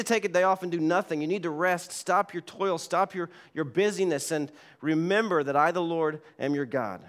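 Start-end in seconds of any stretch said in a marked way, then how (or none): none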